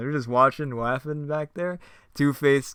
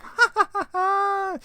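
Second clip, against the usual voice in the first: high villain cackle; evil cackle; 7 dB louder than usual. Acted. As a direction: cackle like a mad clown. Joker's evil laugh.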